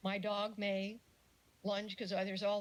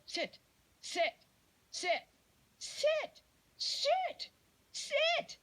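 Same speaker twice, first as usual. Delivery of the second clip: mock dog owner barking sit, rising higher and louder; escalating frantic commands at the dog; repeated shouted sit; 5 dB louder, much slower than usual. Dog owner yelling at leash dog, rising to a shout. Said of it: "and they'll go".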